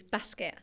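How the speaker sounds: "basket" with the short a of cat; flat Northern a in "basket"; Nottingham short vowel. Shown as a demonstration. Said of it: "I still say basket instead of basket".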